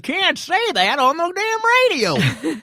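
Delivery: put-on high, slow, loud old mountain man; rumbling mountain voice, hammed up; Adirondack Mountain voice. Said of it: "He goes into his Adirondack Mountain voice".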